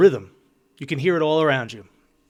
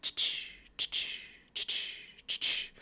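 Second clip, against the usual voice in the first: breathy ch-ch copier chug, softer; voiceless rhythmic copier sound; copier noise; 11 dB quieter, much slower than usual. Retold or imitated. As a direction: imitate a copy machine churning in rhythm. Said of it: "the copier and you're like".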